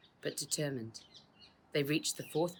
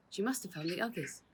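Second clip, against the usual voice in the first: usual speaking voice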